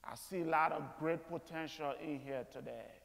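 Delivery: ordinary delivery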